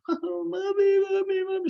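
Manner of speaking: put-on high, wailing little girl calling mommy; crying girl. Girl coming home in tears, crying for mommy. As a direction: wail it like a tearful child.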